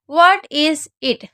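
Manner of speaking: drawn-out dictation of the phrase; very slow read. Shown as a demonstration. Teacher reading the example very slowly. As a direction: spell it out slowly for the class. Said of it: "reads the example dialogue".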